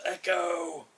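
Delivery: high, loud call into a tower; yelling out. Acted. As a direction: call out to hear it echo. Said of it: "As he yells out".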